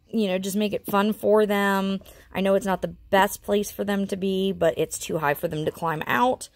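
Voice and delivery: normal talking voice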